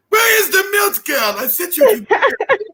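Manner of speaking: high shouted mom voice breaking into a laugh; mock yelling mom. Mom yelling at her daughter, laughing through it. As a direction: mom yells for the milk while the teller laughs.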